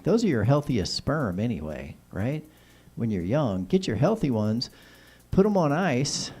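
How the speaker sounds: ordinary talk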